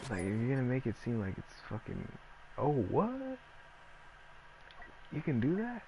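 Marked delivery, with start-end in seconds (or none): 2.6-5.8 sarcastic fake surprise. Sarcastically pretending to learn you can leave, in mock wonder. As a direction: play dumb, fake wowed.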